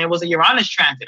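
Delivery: normal talking voice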